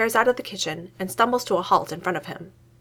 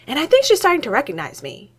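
higher, louder, delighted; happy; 7 semitones higher, 6 dB louder than usual. A narrator's reading. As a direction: gush about your pet. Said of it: "Newton says happily".

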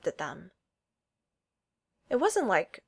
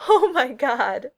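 loud, slow, laughing exclamation; giggling; 5 semitones higher, 9 dB louder, much slower than usual. Acted. Darcy seeing the picture, giggling loudly. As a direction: burst out giggling through the words. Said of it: "she giggles".

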